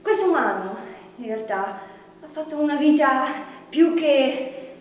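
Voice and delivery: ordinary talk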